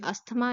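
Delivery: "अस्थमा" with the थ pronounced, as-tha-maa; slip into common pronunciation. Shown as a demonstration. Teacher excusing a possible slip, common pronunciation. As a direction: say "अस्थमा" the everyday Indian way, th sounded. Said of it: "मुँह से गलती से निकल जाए".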